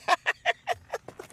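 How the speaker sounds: a shrill burst of real laughter; high squealing laugh. Real, not acted. Vlogger laughing high at the rival's drama. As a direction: cackle high at the gossip.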